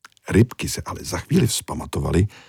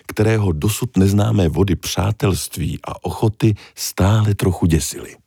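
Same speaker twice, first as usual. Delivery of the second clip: usual speaking voice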